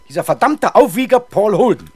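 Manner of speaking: loud, high furious outburst; angry outburst. Acted. Councillor rages at the inspector about the agitator, furious loud outburst. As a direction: spit the name out in rage.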